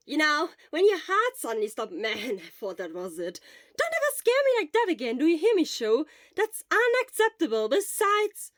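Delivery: loud, high shaken scolding; upset scolding. Acted. Friend loudly scolding Sho after his heart stopped. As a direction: berate him, still rattled.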